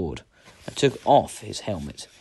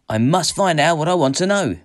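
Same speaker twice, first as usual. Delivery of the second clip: raised, higher, resolute voice; determined insistence; 9 semitones higher, 8 dB louder than usual. A narrator's reading. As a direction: insist loudly against the warnings. Hero insists against his companions' warnings.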